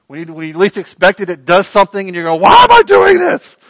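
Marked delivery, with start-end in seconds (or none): 2.4-3.6 high, baffled cry of why am I doing this; bewildered self-question. Retold or imitated. Preacher acting out a startled believer, high bewildered cry. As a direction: sound startled at your own behaviour. Baffled by own heart's outburst.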